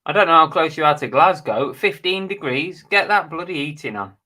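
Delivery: loud, jokey scolding of a chilly viewer; loud teasing. Real, not acted. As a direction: tease them about feeling cold. Teasing viewer about Glasgow temperature.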